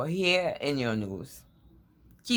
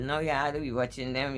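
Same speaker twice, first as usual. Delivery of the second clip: ordinary delivery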